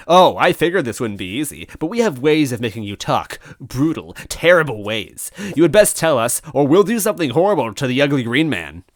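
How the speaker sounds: loud, high villain gloat; gloating threat. Acted. Villain threatening to hurt Luigi, loud and gloating. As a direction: savour each threat.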